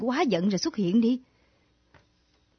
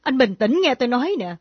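usual speaking voice

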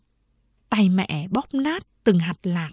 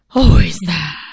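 sighing; 3 semitones lower, 6 dB louder than usual. A narrator's reading. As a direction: heave a big sigh on the words. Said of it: "Bà Lã thở dài". (thở dài thành tiếng, não nề)

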